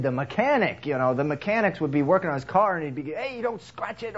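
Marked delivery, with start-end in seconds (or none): 3.1-4.2 high-pitched nagging car lover; fussy car owner. Retold or imitated. Car lover nagging the mechanic in a high fussy voice. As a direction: nag the mechanic about his precious car.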